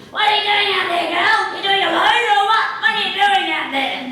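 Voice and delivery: loud, high, fast yelling; wolf shouting. Acted. Wolf yells from house to girl outside, impatient shouting. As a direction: holler impatiently out to someone outside. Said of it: "the wolf is in the house going".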